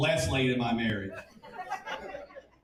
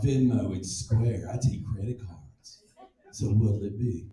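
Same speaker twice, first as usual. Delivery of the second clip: put-on businesslike little girl; 7 semitones lower, slower than usual. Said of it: "She goes".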